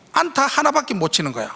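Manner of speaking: ordinary talk, nothing marked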